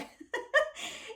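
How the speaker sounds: short high burst of laughter; bright laugh. Real, not acted. Laughing at own canning-book pile.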